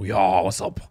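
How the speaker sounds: deep put-on German voice; low German accent. Shown as a demonstration. Showing co-hosts his German accent, pitched low. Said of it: "Unless it's German, then it's low".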